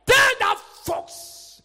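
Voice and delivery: high, drawn-out warning; acted defiant pulpit challenge; defiant declaration. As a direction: send the warning out slowly and forcefully. Acting out a bold public declaration.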